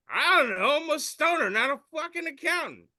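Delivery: high, loud indignant stoner comeback; stoner retort. Acted. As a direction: snap back like an offended stoner. Voicing the stoner's answer.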